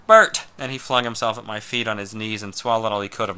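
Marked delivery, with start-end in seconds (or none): 0.1-0.5 loud urgent cries of a name, the second higher; frantic high shout; calling out. Acted. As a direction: call him twice, louder the second time.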